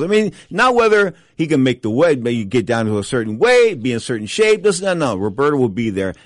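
ordinary delivery

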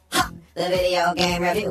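ordinary delivery

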